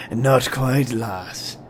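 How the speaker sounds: ordinary talk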